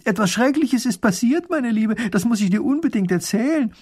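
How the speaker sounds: high, loud call from the window. Acted. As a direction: call across to the neighbour, bursting with news. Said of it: "reißt das Fenster auf und ruft".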